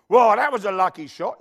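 loud, scoffing "Well, that was a lucky shot."; mock scoffer. Preacher mocking a pool onlooker, loud scoffing. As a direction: throw it out like a know-it-all onlooker.

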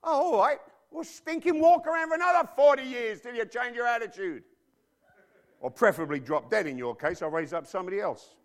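loud, exasperated mock God voice; voicing God fed up. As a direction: play God losing patience, half-joking. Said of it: "God said".

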